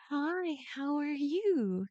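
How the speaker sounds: meeting a strange snake on a throne, slow, high and sweet; overly sweet greeting. Retold or imitated. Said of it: "And so she's like".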